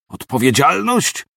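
outburst. Acted. Sergeant bursting out at his officer's accusation, outraged. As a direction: throw the word back in disbelief. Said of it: "wybuchnął Kasjanow".

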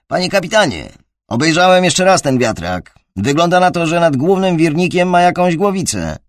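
loud, high urgent report; urgent radio report. A narrator's reading. Crewman reports over radio.

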